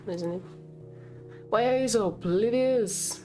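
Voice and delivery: ordinary talk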